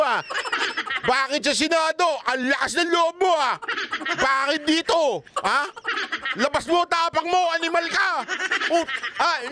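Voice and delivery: high, taunting mock interrogation; mocking angry congressman. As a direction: jeer like a bullying congressman daring him. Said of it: "sasabihin nila". (nanunuyang galit na kongresista)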